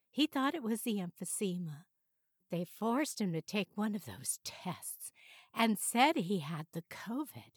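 lightly voiced women, pitch lifted on greetings and questions; mother-daughter phone call. A narrator's reading. Daughter phoning mother about dad, lightly acted women's voices. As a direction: alternate chatty mother and concerned daughter.

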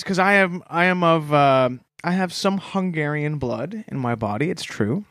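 usual speaking voice

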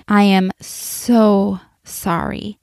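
slow apology with "so" stretched long; retold earnest, lingering "so sorry"; heartfelt apology. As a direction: draw out "so", meaning it. Retold tearful apology to her son.

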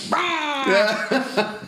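high mock beast roar; imitated roar. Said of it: "they show him going like".

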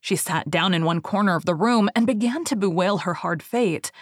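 ordinary delivery

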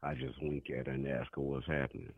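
low quiet seductive murmur; smooth whisper-soft come-on; soft sexy voice. Pretend. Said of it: "Phone sex voice".

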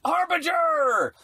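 Harbinger shouted, high and slow; a big dramatic cry; excited shout. Real, not acted. Hyping the doom prophet.

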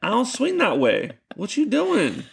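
incredulous push-back. Retold or imitated. To the dude in his shower, a high mock-indignant retort. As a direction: brush off the intruder with half-joking alarm. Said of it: "I give him a look like".

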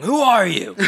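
put-on old man yelling into his phone. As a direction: bark it like a bewildered grandpa. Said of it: "old man ... going".

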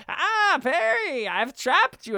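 high, gloating cartoon villain; Doctor Doofenshmirtz. Villain greeting his platypus nemesis, high gloating cartoon voice. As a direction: crow it like a mad scientist. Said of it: "he's just like".

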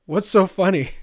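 high, loud giddy question. Acted. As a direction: blurt it out, highly amused. Turner gasping amid shared laughter.

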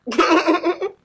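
high, loud cackling laugh; real high-pitched laughter. Real, not acted. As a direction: burst out laughing. Laughs at being high schooler.

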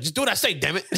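a stubborn grandma laying down the law; bossy grandma. Retold or imitated. Grandma voiced bossing him.

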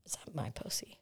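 hushed whisper. Real, not acted. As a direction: whisper it so nobody hears. Whispered question about smell.

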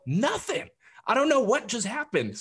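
high-pitched baffled protest, retold; mock bewilderment. Husband telling wife about the encounter, high bewilderment. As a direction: protest high that it makes no sense.

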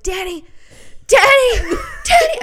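calling his name, climbing to a high yell; re-enacted frantic shouting; panicked calling. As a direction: escalate to a loud, high-pitched wake-up cry. Retelling escalating attempts to wake husband.

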